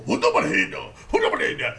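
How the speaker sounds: loud, throaty mock bellow; exaggerated Japanese impression. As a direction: bark it out like a caricature of commanding power. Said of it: "that's how he does it".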